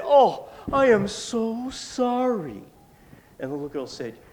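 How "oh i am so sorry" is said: high, loud, drawn-out mock remorse; theatrical pleading sorry; hammed-up apology. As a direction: overplay being terribly sorry, slowly. Trapped spider makes insincere apology.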